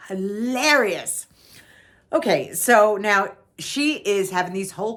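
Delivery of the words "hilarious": slow, fully voiced, stretched out; lingering on the middle of HILARIOUS; drawn-out emphasis. Real, not acted. Same word whispered, then drawn out.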